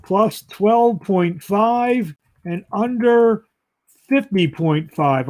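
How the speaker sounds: very slow, drawn-out delivery; measured, lingering read of the bet; deliberate pick announcement. Real, not acted. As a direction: stretch each number out for the reveal. Announcing his betting pick.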